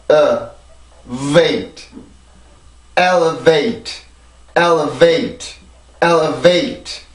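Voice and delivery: elevate built up L, 어, wait then stressed on EL; syllable by syllable elevate, strong first beat; teacher modelling elevate slowly; slow pronunciation drill. Shown as a demonstration. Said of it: "발음을 해보면".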